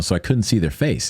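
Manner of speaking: usual speaking voice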